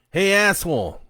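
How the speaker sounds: put-on loud, high angry bark at a sleeping teen; stepdad shouting. A narrator's reading. Stepdad yelling through the bedroom door, loud and angry. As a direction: yell him awake. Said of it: "came his stepdad's voice".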